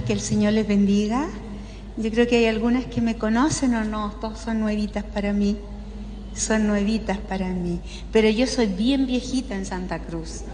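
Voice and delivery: usual speaking voice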